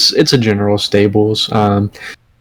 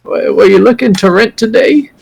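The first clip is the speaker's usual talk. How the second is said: put-on high, frail old lady voice; frail shaky old whisper; 9 semitones higher than usual. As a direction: play a frail old shopkeeper. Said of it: "frail old lady kind of walk up".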